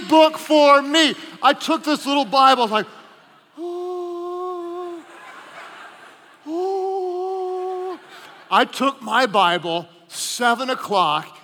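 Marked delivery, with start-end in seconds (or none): 3.6-8.0 delighted ooh. Retold or imitated. Delighted at free Bible.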